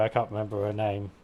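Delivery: ordinary talk, nothing marked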